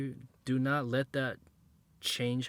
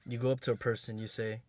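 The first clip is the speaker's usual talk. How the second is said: normal talking voice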